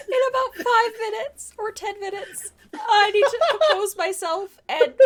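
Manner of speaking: high, helpless laughing announcement; real giggles breaking into the words. Real, not acted. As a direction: struggle to compose yourself through laughter. Breaking up laughing over jokes.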